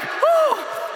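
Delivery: high panicky "oh"; acted panic, pitch shooting up; freaked-out yelp. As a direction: let out a frightened high cry. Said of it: "I start freaking out".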